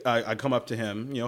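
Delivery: normal talking voice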